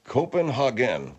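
"Copenhagen" slowly, with "hah" as third syllable; imitated Germanic pronunciation. Host trying out her unfamiliar version, imitated Germanic pronunciation. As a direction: slowly echo "Copenhagen" as koh-pen-HAH-gen. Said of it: "I've never heard it pronounced that way".

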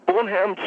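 BONHAM imitated as "BONE-ham"; quoted mispronunciation. Caller blaming the guest for disrespect, quoting BONHAM as "BONE-ham". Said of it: "disrespected him by saying".

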